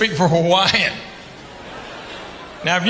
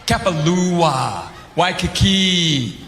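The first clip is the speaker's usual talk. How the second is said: Waikoloa, Kapalua, Waikiki drawn out slowly in Hawaiian style; slow Hawaiian pronunciation; much slower than usual. Shown as a demonstration. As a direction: savour each Hawaiian name slowly like a local. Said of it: "start speaking like a local".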